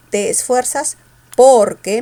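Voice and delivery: "porque" with "por" hit loudly; stress on POR. Shown as a demonstration. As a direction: punch the first syllable of "porque". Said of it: "mayor fuerza en el por".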